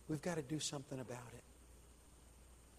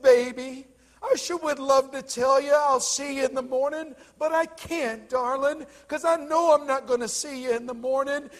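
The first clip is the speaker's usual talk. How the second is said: preached mother's answer; 12 semitones higher, 15 dB louder than usual. A narrator's reading. Preacher at full force as mother.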